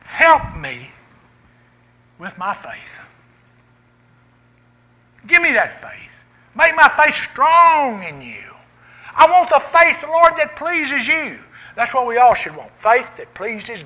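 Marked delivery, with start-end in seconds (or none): 0.0-11.4 rising, loud pleading prayer, slow in places; fervent prayer. Acted. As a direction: cry out to the Lord for faith. Quoted prayer pleading to God.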